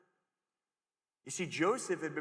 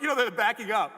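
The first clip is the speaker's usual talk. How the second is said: normal talking voice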